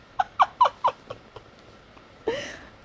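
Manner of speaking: sudden shrill high giggle; high squealing laugh. Real, not acted. Burst of laughter.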